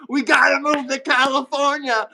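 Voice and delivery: demanding son. Retold or imitated. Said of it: "And his son demanded".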